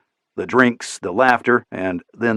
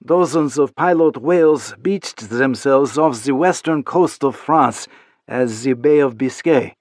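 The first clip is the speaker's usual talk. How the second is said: put-on slow French-accented read of news; 4 semitones higher than usual. Isabella reads the news to Chapman in French accent. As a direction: read the report in her French voice.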